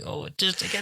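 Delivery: high, soft exasperated whine; teased frustration. Retold or imitated. Fan teased by near-cameos, high exasperated whine. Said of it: "being teased and thinking".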